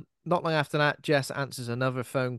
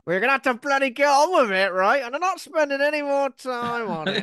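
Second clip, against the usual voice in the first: mock impatient cop; 12 semitones higher, 6 dB louder than usual. Mock police losing patience.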